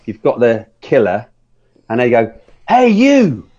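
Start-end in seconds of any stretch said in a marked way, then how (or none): 2.7-3.5 high, loud call of "hey, you"; mock shout. Retelling the film's gunman calling out, high mock shout. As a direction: bark it like a dumb movie hero. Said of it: "Why would you shout, 'Hey, you'?".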